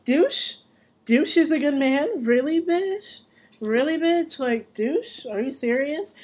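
high, disbelieving cry of really; incredulous outrage. Retold or imitated. Daughter mocking mom's new man, incredulous and high. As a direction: scoff at her in shrill disbelief.

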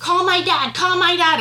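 high, loud imitation of an upset child; retold demand. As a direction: mimic an insistent, repeated demand. Retold stepdaughter's demand in quarrel.

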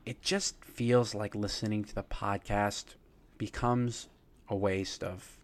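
painfully slow, halting delivery; mock slow talker. Shown as a demonstration. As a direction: drag it out with long pauses between phrases. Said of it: "they speak at this speed".

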